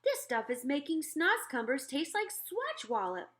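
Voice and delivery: gushing over the food; giant's voice. Acted. Giant delighted by breakfast.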